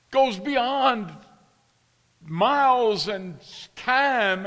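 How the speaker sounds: loud, high, urgent pulpit delivery; impassioned preaching. Real, not acted. Preacher urging the congregation about worship, impassioned. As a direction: build to a ringing, full-force sermon.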